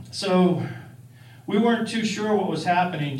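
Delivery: normal talking voice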